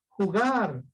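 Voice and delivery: Jugar with the final A stretched; stress on last syllable. Shown as a demonstration. Teacher giving an aguda example, stress on GAR in Jugar. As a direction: lean on the end of Jugar. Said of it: "fuerza de voz en la última".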